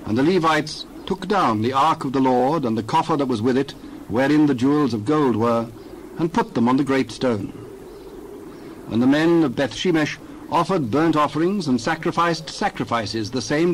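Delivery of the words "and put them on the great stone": ordinary delivery, nothing marked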